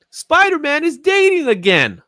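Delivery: high, loud, mock-excited announcement; mock promotional hype. Publisher promoting that Spider-Man is dating, mocked with fake excitement. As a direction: sing out the silly sales pitch.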